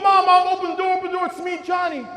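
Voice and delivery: high, loud calls to Mom through the door; desperate pleading shout. Retold or imitated. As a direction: beg her to open up, shouting. Boy banging on door, begging.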